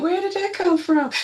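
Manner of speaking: high-pitched imitation of a taken-aback listener; mock surprise. Imitating someone just told off, high mock surprise. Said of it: "and you'll be like".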